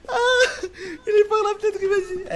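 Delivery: loud high laughing shout; real laughing yell. Real, not acted. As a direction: burst out laughing as you call it. Loud laughing outburst at window.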